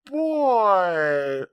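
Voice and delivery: high, loud, long-drawn exclamation; stunned reaction. Retold or imitated. Viewer reacting to an unexpected story turn, stunned. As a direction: stretch it out in mock astonishment.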